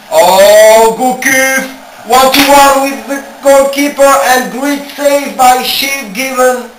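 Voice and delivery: excited commentary. Acted. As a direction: build the tension to the save. Chance and save in commentary.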